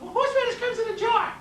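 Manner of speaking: mocking know-it-all. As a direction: play the kid who thinks he knows better.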